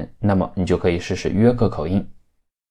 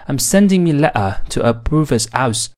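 higher Mancunian, "my" as "me", H and T dropped; Manchester accent; 4 semitones higher than usual. Shown as a demonstration. Teacher combining all the features for learners, Manchester accent. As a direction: Manchester accent with "brother's" as "bruvver's". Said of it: "所有特点汇总后".

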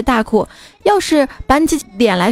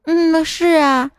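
put-on little girl voice; 6 semitones higher, much slower than usual. Little girl answers the boy.

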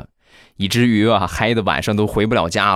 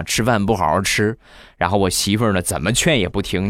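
ordinary talk, nothing marked

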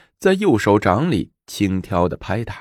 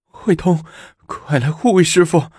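calling for help; 9 semitones higher than usual. Acted. Abbess calls for help.

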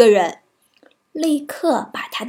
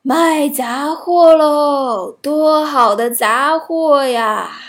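put-on slow, loud old woman's cry; old peddler calling; 3 semitones higher, 5 dB louder, much slower than usual. A narrator's reading. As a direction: hawk wares like an old crone. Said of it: "她敲着门喊道".

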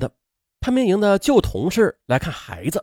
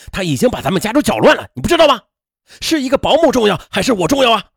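loud, high, heated husband; angry outburst; 6 semitones higher, 5 dB louder, faster than usual. A narrator's reading. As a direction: build into a demanding shout. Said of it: "程丽雨火了". (火冒三丈，高声质问)

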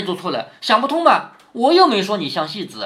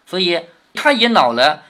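ordinary talk, nothing marked

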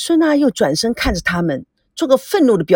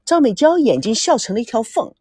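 ordinary delivery, nothing marked

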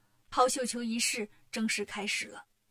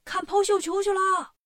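higher, louder excited call; crowd shouting; 6 semitones higher, 6 dB louder than usual. Acted. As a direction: call out to the crowd running ahead. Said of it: "一边跑一边喊".

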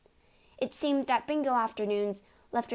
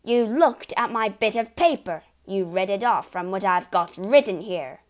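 angry accusation; 9 dB louder than usual. Acted. Father accuses Matilda.

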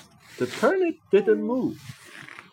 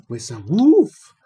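woof; 6 semitones lower, 6 dB louder than usual. A narrator's reading. Dog bounds in barking.

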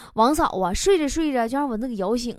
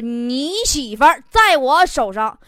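loud, higher, slowly measured ransom demand; kidnapper's threat; 3 semitones higher, 5 dB louder, slower than usual. Acted. Kidnapper phones Lao Wang for ransom, loud deliberate acted threat. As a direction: lay down the threat word by word. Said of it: "劫匪呀，绑票还打电话给隔壁老王呢".

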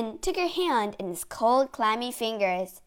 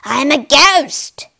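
usual speaking voice

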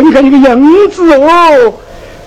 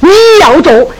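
scornful scolding; 8 semitones higher, faster than usual. Acted. Innkeeper scolds the scholar.

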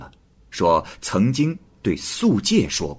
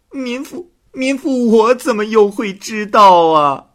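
very high, shrill crying out of innocence; loud wailing protest; 6 dB louder than usual. Acted. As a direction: wail that she's been wronged. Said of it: "王氏大声地叫喊道". (王氏高声喊冤)

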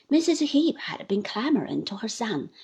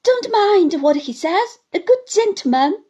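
mother pleading; 9 semitones higher, 9 dB louder than usual. Acted. Mother begs the gentleman, high and loud. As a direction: beg desperately. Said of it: "cried his mother".

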